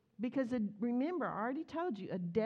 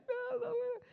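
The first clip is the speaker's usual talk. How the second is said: put-on much higher, a toddler protesting; whiny child. Daughter protesting closed window, high child whine. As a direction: whine it like a little kid. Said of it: "She's like".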